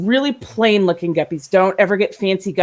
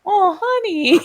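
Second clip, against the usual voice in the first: tender sympathy; 12 semitones higher, slower than usual. Retold or imitated. Owner pitying the fish searching for her friend, tender. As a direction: comfort a sad little fish.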